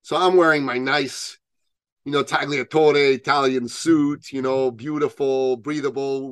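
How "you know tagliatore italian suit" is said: ordinary talk, nothing marked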